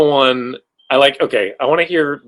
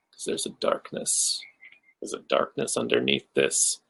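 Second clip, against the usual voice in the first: hushed, playfully sinister confession; mock ominous; 11 dB quieter than usual. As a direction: drop to a dark, barely voiced murmur. Mock-ominous reveal of a dark side.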